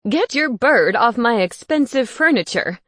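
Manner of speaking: loud indignant outcry; crying out. Acted. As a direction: yell at the bird on the furniture. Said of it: "Gareth cried".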